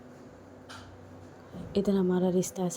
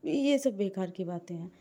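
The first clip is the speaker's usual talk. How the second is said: plain reading voice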